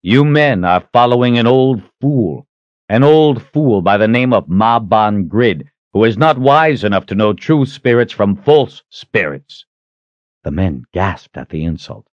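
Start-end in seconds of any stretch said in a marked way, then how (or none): 0.0-9.6 raised, ringing contempt; commanding, scornful hero; defiant challenger. Acted. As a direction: declaim the insult to a crowd. Richard insults the old leader.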